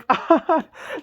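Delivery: squeaky high laugh; giggling at herself; high giggle. Real, not acted. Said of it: "She doesn't even know how to tell a story".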